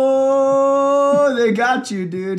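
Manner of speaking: very high, loud drawn-out "oh"; gleeful teasing. Real, not acted. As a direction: crow at a friend who got caught. Gleefully teases the scammed friend.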